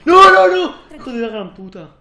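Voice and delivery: very high, loud scream dropping into a curse; startled scream. Real, not acted. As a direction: shriek in fright, then swear. Sudden scare in horror game. (grito de susto y palabrota)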